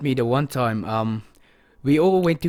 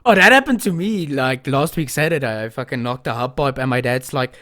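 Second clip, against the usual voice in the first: ordinary talk, nothing marked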